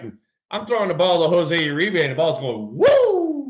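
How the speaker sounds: ordinary delivery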